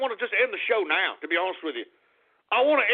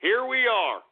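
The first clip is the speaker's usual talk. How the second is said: usual speaking voice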